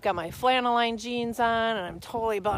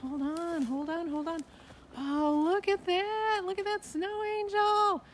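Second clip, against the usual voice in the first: delighted awe; 7 semitones higher, 4 dB quieter than usual. Real, not acted. Admiring finished snow angel.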